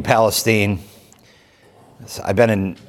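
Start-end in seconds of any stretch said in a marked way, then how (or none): 0.0-0.8 "Palestine" corrected to rhyme its ending with "seen"; Palestine ending in "steen". Shown as a demonstration. Accepting a listener's correction, "Palestine" said PAL-uh-steen. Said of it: "Yes, thank you".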